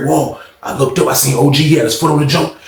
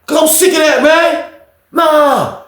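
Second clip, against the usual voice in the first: high loud furious rant; fed up and yelling; angry rant; 13 semitones higher, 3 dB louder, slower than usual. Retold or imitated. Old head ranting about newcomers, high yell.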